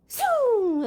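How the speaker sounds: loud, high swooping whoosh; airplane whoosh. Acted. Reader imitating airplane crossing classroom, loud high swoosh. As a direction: swoop from high downward like a flying plane.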